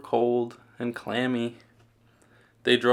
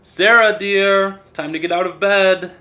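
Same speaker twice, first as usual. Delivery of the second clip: higher, louder cheerful dad calling upstairs; father waking daughter; 8 semitones higher, 8 dB louder than usual. A narrator's reading. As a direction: sing out her name to wake her. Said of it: "Mister Hartwell said".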